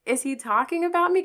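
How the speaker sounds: high, startled is he talking about me; shocked question. Retold or imitated. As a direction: jump up in disbelief. Hearing him on radio.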